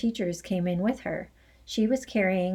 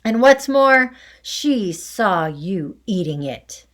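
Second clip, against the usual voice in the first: loud accusation; 3 semitones higher, 7 dB louder than usual. Acted. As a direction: hammer it home. Headmistress accusing loudly.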